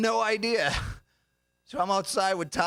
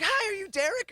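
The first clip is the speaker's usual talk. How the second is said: very high, perky girl impression; imitated date. Date greeting him at the door, high-pitched mimicry.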